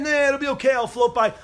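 dismissive. Retold or imitated. Careless youth voiced dismissively.

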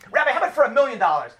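a client begging for an exception; pleading client. Retold or imitated. Client begs the Rav for an exception, eager and quick. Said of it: "client pleading with the Rav".

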